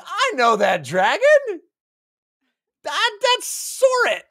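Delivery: high, slow shocked voice; slow, high stricken voice; stunned recognition. Retold or imitated. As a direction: gasp it out in disbelief. Ikariam recognizes crucified dragon.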